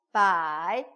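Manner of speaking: 바이 low and drawn, in Mandarin third tone. Shown as a demonstration. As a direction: say 바이 dipping low in pitch. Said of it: "성조가 삼성입니다".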